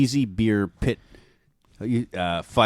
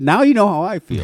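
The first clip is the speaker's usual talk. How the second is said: high, louder smug retort; smug comeback; 11 semitones higher, 6 dB louder than usual. Retold or imitated. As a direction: toss it back, pitched up and pleased. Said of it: "I said".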